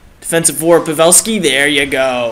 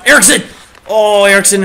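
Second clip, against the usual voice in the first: high, loud cry of the name; sudden excited call; excited shout; 5 semitones higher, 5 dB louder, faster than usual. Real, not acted. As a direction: shout the player's name at the chance. Calling a sudden scoring chance.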